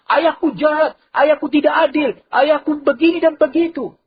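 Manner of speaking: high, loud shrieking complaint; screaming son. Acted. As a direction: scream it out in the yard for neighbours. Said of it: "dia jerit-jerit".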